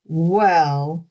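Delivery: lightly growled troll; a touch of grudging troll growl. A narrator's reading. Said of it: "growled the troll".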